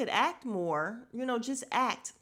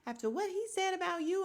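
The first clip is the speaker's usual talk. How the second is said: pitched-up mock outrage; imitating Candy; 9 semitones higher than usual. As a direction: repeat it in disbelief, then climb high. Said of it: "then Candy's like".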